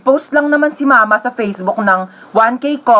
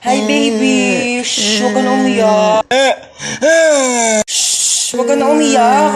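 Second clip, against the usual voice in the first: put-on hushing a baby; slower than usual. Newborn soothed.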